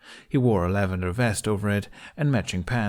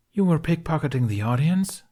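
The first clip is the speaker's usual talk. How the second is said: surprised question; 8 semitones higher, 3 dB louder than usual. A narrator's reading. Jack asks, surprised.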